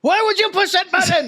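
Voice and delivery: very high-pitched mock exasperation; exasperated scammer impression. Scammer scolding a victim on TikTok, high mock exasperation. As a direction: squeal in put-on disbelief.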